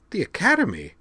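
high, shocked blurt. A narrator's reading. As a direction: burst out in high disbelief. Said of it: "Xenia blurted".

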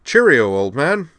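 high, loud hearty toff farewell. A narrator's reading. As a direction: call it out loudly and jovially. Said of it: "he replied in a louder tone".

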